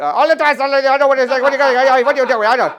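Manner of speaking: loud, high, rapid accent impression of a friend; friend's American-Indian accent. Comic imitates his Indian American friend, loud accent impression. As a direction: do the friend's mixed accent at full tilt. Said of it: "that's his real voice".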